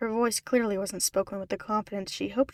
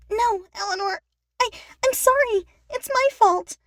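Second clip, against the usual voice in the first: high, louder, stammering plea; desperate apology; 12 semitones higher, 10 dB louder than usual. A narrator's reading. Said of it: "Brittany said desperately".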